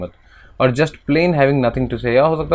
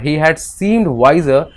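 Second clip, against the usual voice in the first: slow, careful text reading; measured classroom reading; reading aloud; 3 semitones higher, 3 dB louder, much slower than usual. Acted. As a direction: read it out slowly like a passage. Reads English text aloud.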